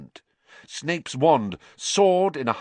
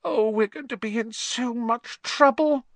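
frightened; 13 semitones higher than usual. Acted. Hermione frightened.

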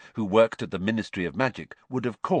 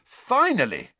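high, louder cry of relief at last; exclaiming; 12 semitones higher, 5 dB louder than usual. A narrator's reading. Ron greeting Harry as he sits down, exclaiming. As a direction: burst out with it, pitched right up.

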